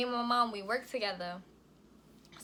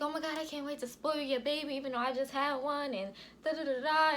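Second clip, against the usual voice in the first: higher-pitched imitation of a gushing sister; gushing excitement; 5 semitones higher than usual. Sister can't wait to spoil the baby, imitated gushing. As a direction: gush in a raised voice, then trail into filler.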